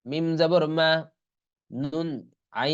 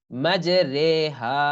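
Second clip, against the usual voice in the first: ordinary talk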